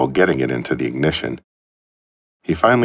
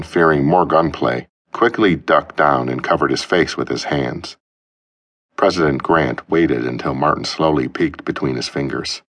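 ordinary delivery

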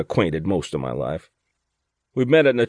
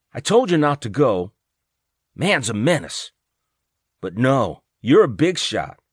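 angry scolding; 5 semitones higher than usual. A narrator's reading. Wife scolds her injured husband in a hospital bed, angry and high. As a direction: give him a furious telling-off. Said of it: "angry and worried".

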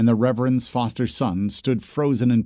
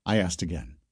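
usual speaking voice

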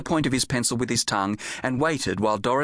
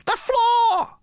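high, louder cry of "The floor!"; alarmed call; 5 dB louder than usual. Acted. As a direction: call down urgently from the shelf. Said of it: "called down to Jim".

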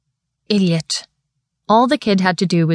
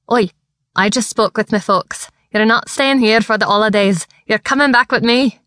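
put-on excited Scottish boy, rising higher; Scottish lad bursting with news; broad Scottish accent; 4 semitones higher than usual. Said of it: "His thick Scottish accent".

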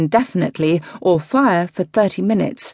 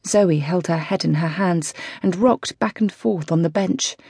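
ordinary delivery, nothing marked

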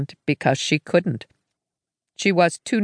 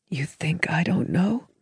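whisper; 4 dB quieter than usual. Acted. Grieving sister snaps back softly.

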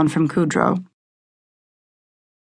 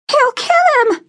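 much higher, louder panicked shriek; 5 dB louder than usual. A narrator's reading. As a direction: shriek it in alarm. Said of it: "someone shrieked".

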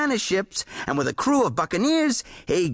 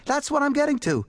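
higher, huffy pretend offense; mock offended; 10 semitones higher than usual. A narrator's reading. Horace pretends to be offended at the editor, higher and huffy. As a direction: act offended, put on. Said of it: "pretending to be much offended".

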